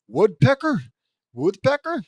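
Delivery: loud, high, startled question; incredulous. Acted. Wally asking about his old neighbour, incredulous. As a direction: squawk it in mock disbelief.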